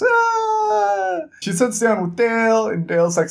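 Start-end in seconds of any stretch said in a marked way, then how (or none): 0.0-1.2 high, sustained "aah" of cheering; mock crowd cheer. Bar hearing Jazz will pay for drinks, high mock cheer. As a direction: imitate a whole bar cheering.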